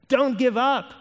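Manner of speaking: high, emphatic urging; urgent exhortation. Acted. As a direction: push it out high and firm.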